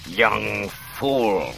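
raspy, drawn-out villain voice; Palpatine rasping. A narrator's reading. Emperor taunting nearly unconscious Luke, rasping. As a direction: rasp it with contempt. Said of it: "Palpatine rasped at Luke".

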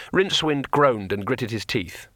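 usual speaking voice

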